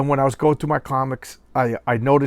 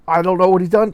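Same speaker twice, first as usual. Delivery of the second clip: exasperated rant; 6 semitones higher, 7 dB louder, faster than usual. Real, not acted. Rant about writer.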